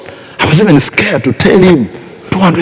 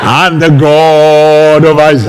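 booming God voice. Shown as a demonstration. Preacher parodying a dramatic God speaking to Abraham, booming. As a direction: announce it like a thundering deity. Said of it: "God is not going to speak like this".

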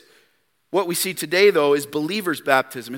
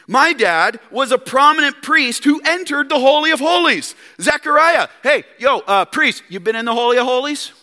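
higher, louder showing off; smug bragging; 9 semitones higher, 6 dB louder than usual. Retold or imitated. John bragging to priests about his father, smug. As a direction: lord it over the priests. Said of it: "our flesh wanna do this".

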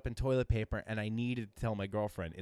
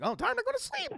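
very high squeaky bird voice; mocking Tony as a sleepy parakeet; 5 dB louder than usual. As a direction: chirp it like a parakeet. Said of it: "he's like".

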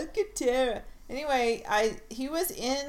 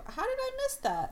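high, astonished question; surprised; faster than usual. Real, not acted. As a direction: squeal in disbelief. Surprised at the news.

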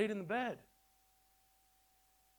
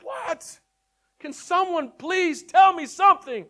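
much higher, louder, slow desperate demand; exasperated plea; 14 semitones higher, 14 dB louder, slower than usual. Retold or imitated. Grandson begging his grandpa to explain, loud exasperated cry. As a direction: beg for an answer, at the end of your rope.

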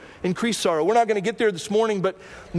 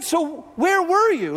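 higher, slow, exasperated "So where were you?" with "were" pressed hard; frustrated demand; 10 semitones higher, 3 dB louder, slower than usual. Retold or imitated. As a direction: plead it slowly, hurt and fed up. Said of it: "she goes".

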